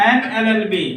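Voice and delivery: usual speaking voice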